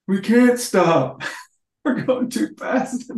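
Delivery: high, slow panicked voice; pleading that they cannot stop; panicked movie quote. Retold or imitated. Retelling the movie to friends, high and slow panic. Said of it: "you know".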